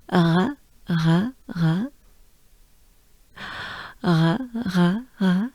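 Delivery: "re" breathed out as a French R; short separate "re", tongue held down while exhaling. Shown as a demonstration. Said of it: "keep your tongue down in your mouth".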